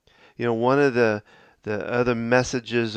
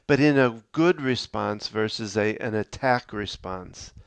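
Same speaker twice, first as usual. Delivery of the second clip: ordinary delivery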